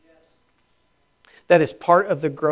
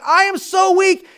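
high, drawn-out whine of a weak believer; mock weak confession; 6 dB louder than usual. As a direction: voice the self-pitying churchgoer. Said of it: "And you're saying".

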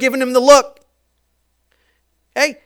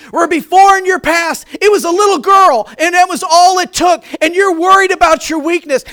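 louder, higher, rising sermon climax; preaching at full force; 7 semitones higher, 6 dB louder than usual. Acted. Preacher voicing Jesus to Peter, full-force preaching. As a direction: drive it home to the congregation.

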